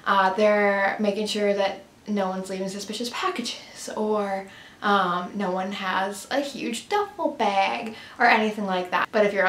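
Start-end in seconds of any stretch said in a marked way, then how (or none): none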